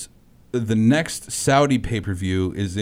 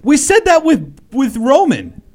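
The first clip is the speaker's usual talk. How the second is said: excited; 7 dB louder, slower than usual. Real, not acted. Heated sports argument, pitch jump.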